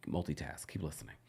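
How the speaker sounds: breathy stage whisper. Pretend. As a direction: drop to a secret whisper. Joking aside about working.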